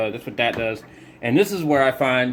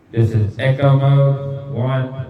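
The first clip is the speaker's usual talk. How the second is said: short repeating echo on the voice; mic echo, drawn-out last word; slow demo through short echo; echo mode one; 2 semitones higher, 4 dB louder, slower than usual. Shown as a demonstration. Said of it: "if I turn mic echo on".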